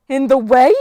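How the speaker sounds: loud, high, indignant echo rising at the end; offended goddess, sharply incredulous; outraged question. Acted. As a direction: throw it back as an affronted question. Struck goddess answers in outrage.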